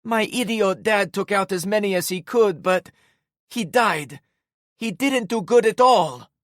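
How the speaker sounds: high, loud bitter outburst; mean tearful rant. A narrator's reading. Em grieving her dad, high loud bitter rant. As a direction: lash out through grief. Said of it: "Despite her mean tone".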